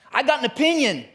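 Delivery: high, loud blurting interruption; mock know-it-all.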